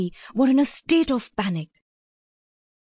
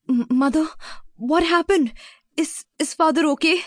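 worried, halting, raised pitch; anxious questioning; 5 semitones higher than usual. Acted. Sarah running up to her mother about Father, anxious. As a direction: stumble anxiously over the first words.

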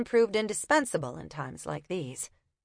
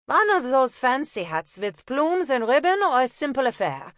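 put-on higher, louder German-accented question; 6 semitones higher, 5 dB louder than usual. Una needling the copper in a German accent. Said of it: "feigning a German accent".

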